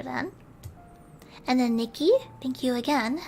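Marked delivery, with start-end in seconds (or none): none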